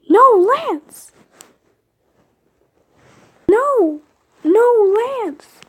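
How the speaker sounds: high loud scream of no; anguished scream. Acted. Watching Lance stay behind, high anguished scream. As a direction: tear the word out as he's lost.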